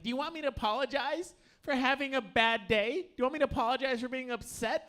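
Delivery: mock furious girlfriend rising on each question; angry girlfriend. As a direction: throw her question back at him, hurt and mad. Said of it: "she was just like".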